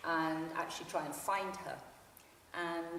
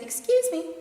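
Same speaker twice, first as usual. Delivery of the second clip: put-on high, loud fluting voice like telling a child what to do; 12 semitones higher, 10 dB louder than usual. Woman on the train asks to get out, high and fluting. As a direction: sing it up high like ordering a child about. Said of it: "in a high fluting voice that people use to tell a child".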